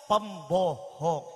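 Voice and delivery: very slow, low-pitched verdict; drawn-out accusation. Acted. Mut'im delivers his final accusation to Muhammad, slow and low. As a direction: drop low and stretch out each syllable. Acted accusation closing the harangue.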